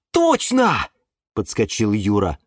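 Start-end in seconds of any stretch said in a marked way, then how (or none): 0.1-0.9 high, excited exclamation; excited realisation. A narrator's reading. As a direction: jump on the idea with delight. Юра подскочил от радости. (радостно, высоким голосом)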